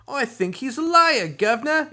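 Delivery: put-on high, loud hammy Cockney accent. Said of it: "we do this Cockney accent".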